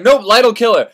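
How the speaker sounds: curt refusal. Retold or imitated. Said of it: "And they're like".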